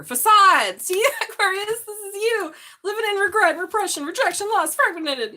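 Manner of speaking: high, loud, animated reading; excited card reading. Real, not acted. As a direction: announce each card with rising excitement.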